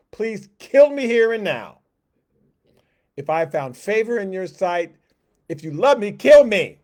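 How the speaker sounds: a full-voiced despairing shout of a worn-out leader; desperate cry. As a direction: cry out to God, begging for it to end. Moses begging God to die.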